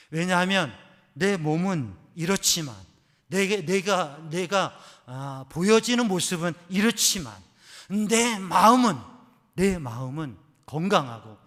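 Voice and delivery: loud, ringing testimony; preaching at full force. Acted. Preacher voicing her confession, loud and fervent. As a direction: proclaim it to the congregation.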